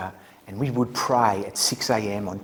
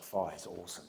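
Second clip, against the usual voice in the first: normal talking voice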